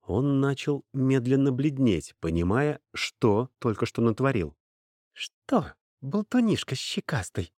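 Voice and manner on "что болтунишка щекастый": higher-pitched, sweetly hateful taunt; a touch of affectionate venom, pitched up; tender hatred. A narrator's reading. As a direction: coo it with understated hatred. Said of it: "с ласковой ненавистью в голосе".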